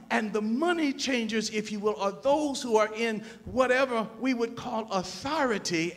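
loud, high-pitched sermon delivery; impassioned preaching. Real, not acted. Preaching at full force.

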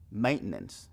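"Maintenance" quick as menens; fast "Maintenance", menens; quick version. Shown as a demonstration. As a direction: clip "Maintenance" to two syllables. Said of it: "Eso se escucha un poco rápido".

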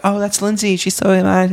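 lightly voiced high girlish friend; high, bright imitation of a girl friend; girly friend voice. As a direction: mimic the friend, pitched high and sweet. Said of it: "she's like".

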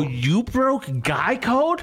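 high, drawn-out mock disbelief; retold accusation, incredulous and high; betrayed disbelief. Retells accusing his father of betrayal.

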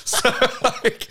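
shopkeeper's dubbed scream, hammed-up; imitated scream. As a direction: squeal it like a badly dubbed scream. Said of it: "the sound of his voice going".

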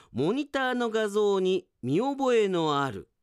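normal talking voice

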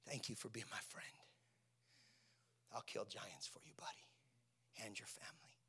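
soft, low near-whisper; hushed, low and heartfelt; tender aside. Acted. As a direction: murmur it privately to a close friend.

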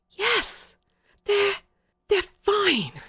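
high, halting, breath caught in delight; gasping pleasure. A narrator's reading. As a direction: try to hide your delight and fail. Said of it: "I hadn't meant to show pleasure".

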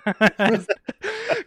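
cracking up at a dumb movie line; burst of laughter. Real, not acted. Mocking the movie scene.

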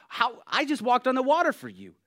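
loud, high-pitched indignant outburst; bucking up in protest. Acted. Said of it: "I wanna buck up".

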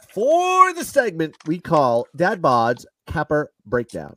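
slow, hyped-up show announcement; segment announcer. Pretend. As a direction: stretch it out like a big segment intro. Introducing a named podcast segment.

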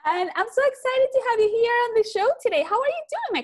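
high, bright-eyed excited welcome. Real, not acted. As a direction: greet her with real excitement. Host greets guest with enthusiasm.

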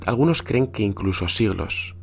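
hushed campfire horror narration; spooky storytelling. Acted. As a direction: tell it like a scary bedtime tale. Tells Santa as a horror tale.

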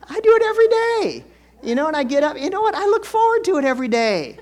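loud, high-pitched enthusiasm; excited shout. Real, not acted. Preacher confessing his boring oatmeal habit, loudly excited. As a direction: belt it out with over-the-top eagerness.

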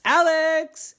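high, sung-out call of a name. Acted. Kids looking for Alex, calling out high. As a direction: call for someone lost in the house.

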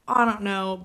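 usual speaking voice

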